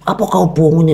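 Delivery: meniru bentakan anak, nada tinggi; anak marah. Retold or imitated. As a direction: marah kecil tiruan. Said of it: "marah dia". (anak marah pada opung yang usil, bentakan tiruan)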